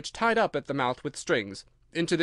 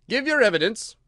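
raised, loud royal order; commanding king; 8 semitones higher, 7 dB louder than usual. A narrator's reading. King orders the nervous Hatter to testify, loud and commanding. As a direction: order the witness firmly.

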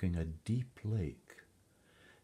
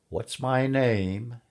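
louder, higher, drawn-out demand; slow, menacing storybook imp; malicious imp; 7 semitones higher, 7 dB louder, slower than usual. A narrator's reading. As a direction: demand it slowly as a taunting imp.

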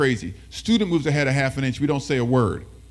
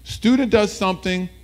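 ordinary delivery

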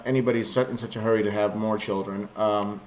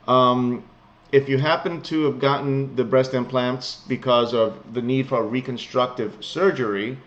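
normal talking voice

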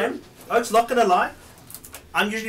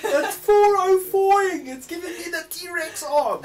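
laughing; 12 semitones higher, 6 dB louder than usual. Real, not acted. Laughing joke about broken internet.

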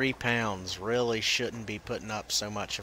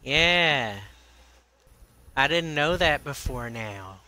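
mock-grateful, drawn out and slightly raised; facetious; 4 semitones higher, 4 dB louder than usual. Real, not acted. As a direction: play up being impressed, tongue in cheek. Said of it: "You're just being facetious".

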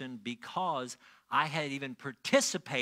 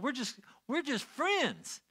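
usual speaking voice

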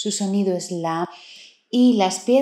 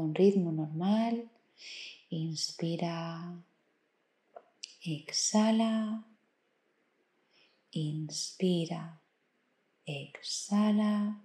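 soft, slow meditation voice with long pauses; guided meditation; 7 dB quieter, slower than usual. Acted. Meditation guide leading the listener through counted breathing, calm and slow. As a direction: count the breaths softly, stretching each instruction.

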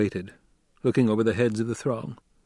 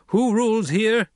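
higher, louder, commanding orator; young duke addressing tribe; 11 semitones higher, 5 dB louder than usual. A narrator's reading. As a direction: challenge the crowd with rising authority. Paul addresses the throng.

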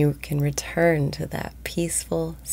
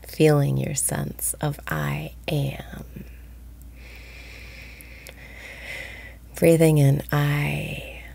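guided meditation. Acted. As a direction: ease listeners into calm, breath by breath. Leads a daily guided meditation.

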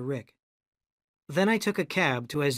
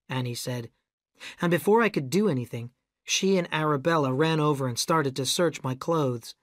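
usual speaking voice

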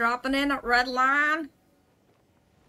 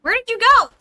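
high, loud shout; real startled call across the map; 11 semitones higher, 16 dB louder than usual. Real, not acted. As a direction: yell out for a teammate who vanished. Calling out for lost teammate.

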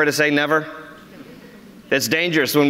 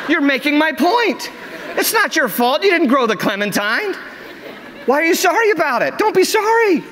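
amused exasperation; 10 semitones higher than usual. Retold or imitated. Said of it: "I said".

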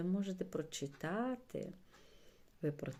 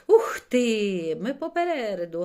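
very loud, exuberant yell; bellowing; 4 semitones higher, 15 dB louder than usual. A narrator's reading. Білик pulls ahead in the race, bellowing happily. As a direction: holler it out in triumph. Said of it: "горлав Білик".

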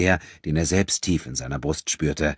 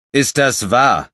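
angry demand; 8 semitones higher, 9 dB louder, slower than usual. Acted. Enrico confronts the stranger.

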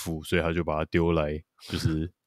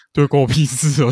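laughing through 对，关我屁事哦, higher and louder; 8 semitones higher, 7 dB louder than usual. Real, not acted. Host laughs out loud repeating James's punchline. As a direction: crack up while repeating the punchline.

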